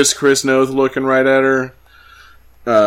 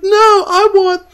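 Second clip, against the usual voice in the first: put-on much higher, slow, pleading girlish voice; mock swooning heroine; 5 dB louder, slower than usual. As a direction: beg for passionate love, high and slow. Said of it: "She's like".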